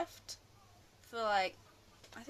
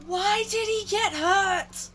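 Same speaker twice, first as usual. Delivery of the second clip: exasperated whine; 10 semitones higher, 9 dB louder, slower than usual. Real, not acted. Exasperated question to friend Keeley.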